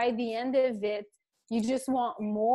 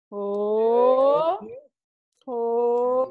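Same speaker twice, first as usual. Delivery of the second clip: loud drawn-out oh oh; exaggerated oh; 8 dB louder than usual. Shown as a demonstration. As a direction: belt out big rising ohs. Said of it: "We're gonna pour on one side".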